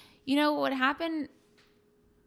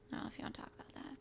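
very soft, hurried whispered aside; hesitant near-whisper; 16 dB quieter, much faster than usual. Real, not acted. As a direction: let it drop to an unsure whisper. Hesitant aside, voice drops away.

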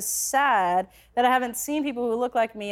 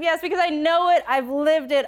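ordinary delivery

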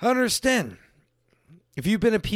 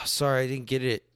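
tired mom; 8 semitones lower, 5 dB quieter than usual. Retold or imitated. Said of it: "And she'd be like".

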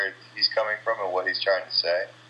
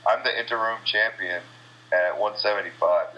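ordinary delivery

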